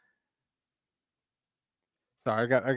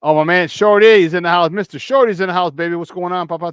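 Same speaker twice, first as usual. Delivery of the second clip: louder, higher hype greeting; excited shout-out; 5 semitones higher, 7 dB louder than usual. Real, not acted. Host welcoming viewer Shorty into the stream, loud hype.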